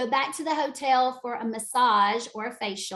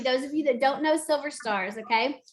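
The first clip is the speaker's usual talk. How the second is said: ordinary delivery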